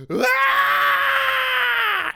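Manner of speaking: long, high, loud acted scream; sudden piercing mock scream; freak-out scream. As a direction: shriek it out and hold it. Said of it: "just, just freaks out".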